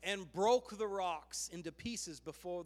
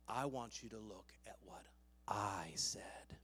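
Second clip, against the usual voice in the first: low, soft, sinking to whisper; God's hushed, firm correction; still small voice; 10 semitones lower, 9 dB quieter than usual. Acted. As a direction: drop right down and end whispered. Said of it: "he speaks to him in that still small voice".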